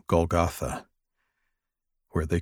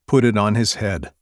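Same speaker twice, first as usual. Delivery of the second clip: ordinary delivery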